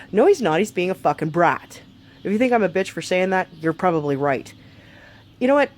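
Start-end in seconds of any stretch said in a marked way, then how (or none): none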